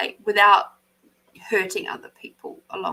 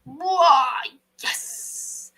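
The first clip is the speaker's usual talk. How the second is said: mock awe; 5 semitones higher than usual. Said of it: "It's just like".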